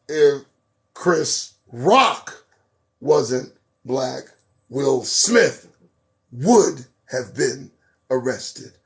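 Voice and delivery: word by word, with weighty pauses; deliberate retort. Real, not acted. Host answering a pundit's claim, slowly and deliberately. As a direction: spell it out in separate chunks, punching the names.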